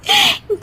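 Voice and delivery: short high embarrassed giggle; giggle, caught out. Acted. Daughter-in-law confesses, laughs. (ऊँची झेंपी हँसी)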